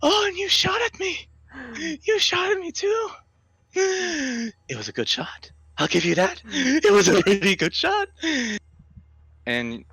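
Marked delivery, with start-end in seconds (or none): 0.0-8.1 very high, creepy praise; eerie high creature voice. Acted. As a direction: compliment the shot like a twisted thing. Creature voiced after crying.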